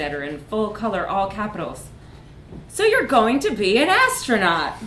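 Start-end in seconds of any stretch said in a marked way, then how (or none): 2.7-4.7 louder, higher, hammed-up advert cheer; mock pamphlet hype. A narrator's reading. Narrator reading the space pamphlet as a cheesy ad. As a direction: sell it like a gaudy brochure. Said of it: "pamphlets under her door that shouted".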